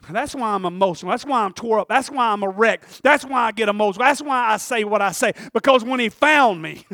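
loud, driving repeated declarations; fervent preaching. Acted. Preacher defending his emotion to the church, fervent preaching. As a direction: hammer each reason out loudly.

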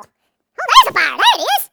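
loud mock sighting of danger; playful shout of discovery; excited alarm cry. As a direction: call it out high and loud. Acts out spotting a fire.

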